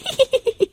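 tittering little-star laughter; high giggle. A narrator's reading. Stars laugh at Lina's landing, high giggle. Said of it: "lachten die Sternchen".

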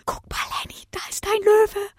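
hohes, tonloses Flüstern eines verängstigten Jungen; flüstere voller Angst ins Ohr; ängstliches Flüstern. A narrator's reading. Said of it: "flüsterte Tom ängstlich". (Tom flüstert Henny ängstlich ins Ohr)

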